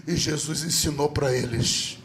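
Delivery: ordinary talk, nothing marked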